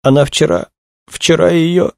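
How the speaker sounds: high, faltering grief; moaning, broken off. A narrator's reading. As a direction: moan it haltingly and break off. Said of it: "простонала молодая женщина".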